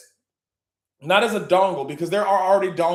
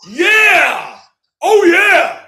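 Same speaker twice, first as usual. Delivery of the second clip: much higher, much louder whooping; ecstatic cheer; 12 semitones higher, 13 dB louder, slower than usual. Real, not acted. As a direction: whoop it up like a hype man. Cheering a guest onto stage.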